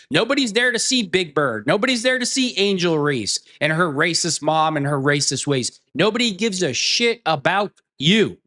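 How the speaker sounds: ordinary talk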